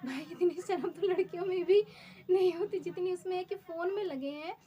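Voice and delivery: real exasperation at the rude groom; indignant outburst. Real, not acted. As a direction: vent the outrage at him. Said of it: "indignant retelling".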